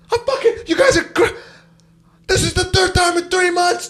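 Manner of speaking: put-on high-pitched old man's outraged rant; worked-up, strained mock yell, voice way up high; imitated yelling man. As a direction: mimic a furious traveller losing it. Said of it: "there's an old man yelling".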